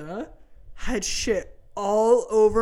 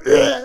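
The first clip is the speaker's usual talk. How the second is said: loud mimicked gagging noise; imitated retch; 3 semitones higher, 7 dB louder than usual. Mother imitating dad covered in poop, retching. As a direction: act out a gag. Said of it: "he's sitting there going".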